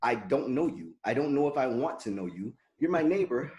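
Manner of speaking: ordinary talk, nothing marked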